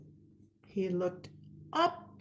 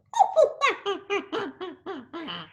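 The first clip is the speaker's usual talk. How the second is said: high playful monkey laugh; monkey chatter laugh; 7 semitones higher than usual. Acted. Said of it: "and said".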